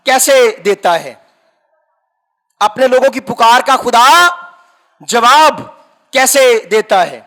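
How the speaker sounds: impassioned preacher pushing the story forward; full-force preaching. Acted. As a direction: preach it out to the congregation.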